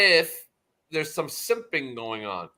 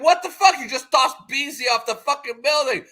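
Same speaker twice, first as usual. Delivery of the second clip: much higher and louder, disbelieving; shocked outburst; 9 semitones higher, 6 dB louder than usual. Retold or imitated. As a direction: react like you just saw someone thrown off. Shocked reaction in retold scene.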